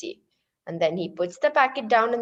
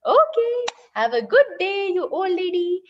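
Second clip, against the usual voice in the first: put-on carefree boy; 8 semitones higher than usual. Carefree boy answers the lady.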